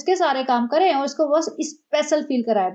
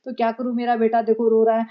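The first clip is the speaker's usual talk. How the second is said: normal talking voice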